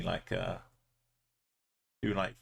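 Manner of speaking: ordinary talk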